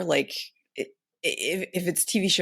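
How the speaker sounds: usual speaking voice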